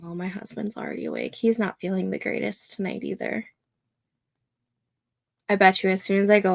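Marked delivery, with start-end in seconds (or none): 0.0-3.5 much softer, quick murmur; hushed aside. Real, not acted. Aside to viewers about husband, hushed.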